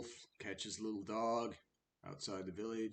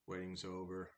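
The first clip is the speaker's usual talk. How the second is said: usual speaking voice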